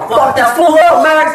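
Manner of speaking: high, excited mock holler; imitated egging-on yell. Friend out the side of his truck urging a fight, imitated yell. Lonzo yelling from truck whole time.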